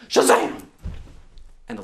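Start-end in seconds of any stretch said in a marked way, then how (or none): 0.1-0.6 magic exclamation. Acted. Leprechaun vanishes.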